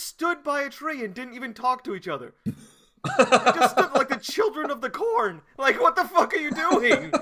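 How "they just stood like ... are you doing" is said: laughing disbelief. Real, not acted. Mocking the silent watchers.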